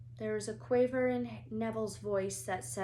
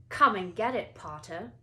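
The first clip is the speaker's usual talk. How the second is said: louder taunting call; 7 dB louder than usual. A narrator's reading. Malfoy calls down from the oak, taunting Harry. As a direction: dare him to come. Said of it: "he called".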